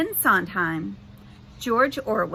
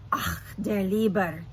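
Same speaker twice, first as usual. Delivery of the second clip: put-on thick German accent, rolling and formal. Holographic Gutenberg greets the teammates, thick German accent. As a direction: greet them like an old German printer. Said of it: "said a man with a thick German accent".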